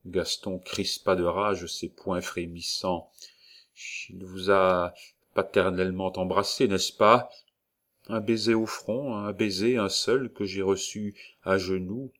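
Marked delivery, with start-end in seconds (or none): none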